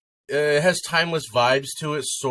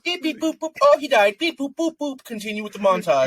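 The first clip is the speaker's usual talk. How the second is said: imitating guitar picking; 14 semitones higher than usual. Imitating whimsical music.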